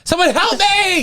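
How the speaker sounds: high, slow fluttering plea for help; fluttering cries for help. Pretend. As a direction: wail it like a helpless mermaid. Mermaid drying up bit.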